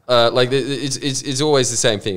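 ordinary talk, nothing marked

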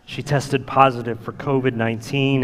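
ordinary talk, nothing marked

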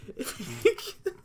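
very high, squeezed laugh; helpless high laughter after venting; exasperated laugh. Real, not acted. Co-host cracks up at own rant, exasperated laugh.

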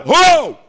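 high, loud cry of alarm; startled "whoa". Acted. Fishermen seeing a tsunami, startled shout. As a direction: yelp as the wave hits. Fishermen see a huge wave arrive.

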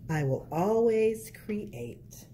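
put-on lilting picture-book narration for kids; reading to children. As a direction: read it like storytime at the library.

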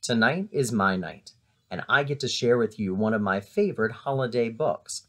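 usual speaking voice

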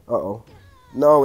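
usual speaking voice

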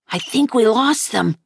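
ordinary delivery

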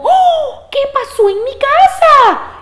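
very high, loud alarm; shocked mother goat. Acted. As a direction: cry out as the startled mother. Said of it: "llegó la mamá y dijo".

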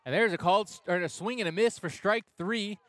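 rising excited play-by-play; excited call. Real, not acted. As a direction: call the strikeout with a burst of excitement. Strikeout call.

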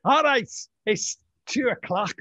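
put-on Scouse accent for a time announcement; Jamie Carragher. Host playing a footballer as speaking clock, Scouse accent. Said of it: "Jamie Carragher being the voice of the speaking clock".

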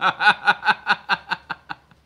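loud cackle that tails off; real uncontrolled belly laugh; hearty laugh. Real, not acted. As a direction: burst into long, rapid staccato laughter. Laughing at viewer's comment.

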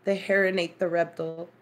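"heron" as HAIR-un, "reptile" as REP-tull; reduced vowels, "heron" HAIR-un, "reptile" REP-tull; other people's pronunciations. Retold or imitated. Trying the weird version, "reptile" as REP-tull. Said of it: "It's a weird thing to say".

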